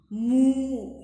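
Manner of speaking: cow moo. Shown as a demonstration. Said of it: "How the cow will make noise?".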